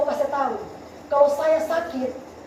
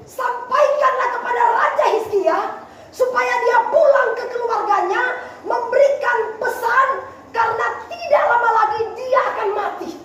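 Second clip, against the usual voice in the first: preaching at full force; 7 semitones higher than usual. Real, not acted. Preacher relaying God's message for King Hizkia, forceful preaching. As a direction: hammer out each phrase to the congregation.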